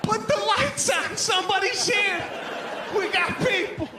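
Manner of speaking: loud, high, thrilled shouting; the whole family yelling with delight; excited family. Retold or imitated. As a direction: shout it like company just arrived.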